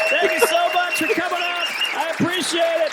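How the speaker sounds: squeaky hammed-up goodbye; goofy outro voice. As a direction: comedian mock-thanking the crowd in a silly high voice. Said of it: "It's a hell of an outro".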